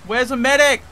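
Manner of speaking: loud, high shouts for a medic; man down shout. Real, not acted. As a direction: yell it across the battlefield. Teammate down, calling for medic.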